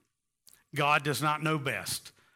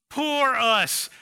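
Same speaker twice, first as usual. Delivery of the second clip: mocking self-pitying whine, higher and louder; mock pity party; 6 semitones higher, 5 dB louder than usual. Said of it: "They had a big old pity party".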